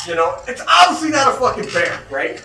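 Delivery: ordinary talk, nothing marked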